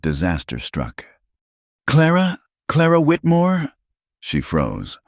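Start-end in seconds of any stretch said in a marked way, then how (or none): 1.9-2.4 a much higher woman's voice calling out in surprise; startled high call. A narrator's reading. Adelaide recognizes Clara in store. 2.7-3.7 a much higher woman's voice, now certain, full name; name her out loud, sure now; full-name high call. A narrator's reading. Adelaide recognizes Clara in store.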